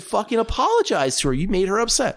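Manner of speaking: mild mock telling-off, pitched high; retold demand. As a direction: order him to apologize.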